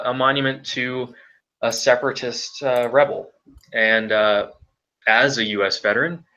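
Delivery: ordinary talk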